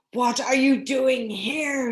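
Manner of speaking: slow, loud gruff giant's cry. Acted. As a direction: bellow it like a cross giant catching intruders. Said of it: "he cried in a very gruff voice".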